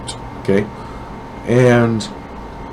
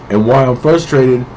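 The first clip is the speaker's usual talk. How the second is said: usual speaking voice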